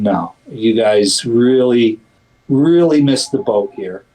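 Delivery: normal talking voice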